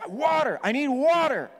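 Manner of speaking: loud, high, urgent yell for water; desperate yell. Retold or imitated. Pastor yelling for water at the gas station, loud and desperate. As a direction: cry out for water as if the ear burns.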